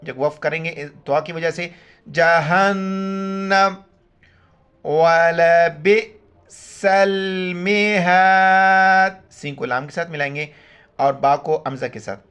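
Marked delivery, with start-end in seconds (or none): 2.1-9.3 Quran recitation. Shown as a demonstration. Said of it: "Quran recitation demo".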